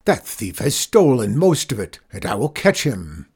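loud, lightly put-on roar, easing off. A narrator's reading. Farmer vows to catch the moon thief, roaring. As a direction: roar a vow, easing down at the end. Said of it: "roared Zeke".

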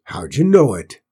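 lightly voiced stableman demanding an answer; questioning stableman. A narrator's reading. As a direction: put the question hard, voice swooping up mid-line. Gruff stableman questions the boy.

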